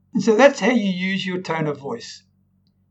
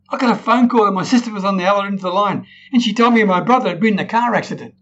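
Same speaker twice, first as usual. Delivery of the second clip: fast, bright upbeat telling, higher and louder; excited and happy; 4 semitones higher, 4 dB louder, faster than usual. Shown as a demonstration. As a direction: gush it quickly as if it were good news. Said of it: "my voice is talking excited and happy".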